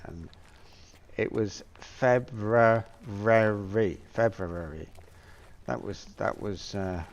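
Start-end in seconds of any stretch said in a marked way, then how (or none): 1.7-4.9 slow Plymouth "February" with the middle lingering; old Plymouth way of saying "February". Shown as a demonstration. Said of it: "Plymouthian accent".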